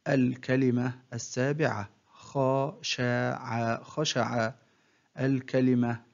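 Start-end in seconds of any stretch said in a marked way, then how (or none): 2.1-3.8 very slow, each syllable drawn out separately; broken into syllables. Shown as a demonstration. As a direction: sound out the word syllable by syllable. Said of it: "تقطع الكلمة إلى حروف". (تقطيع الكلمة ببطء شديد مقطعًا مقطعًا)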